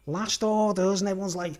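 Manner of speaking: usual speaking voice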